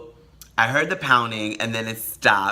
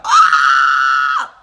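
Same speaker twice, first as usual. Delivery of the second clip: excited shriek; 2 semitones higher, 11 dB louder than usual. Real, not acted. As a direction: squeal with delight at full volume. Thrilled scream after the phrase.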